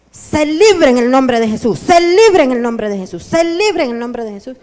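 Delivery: loud, forceful command, said three times; loud deliverance command. Retold or imitated. Pastor commands freedom over the distracted believer, loud forceful declaration. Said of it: "y le dice".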